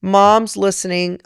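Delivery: normal talking voice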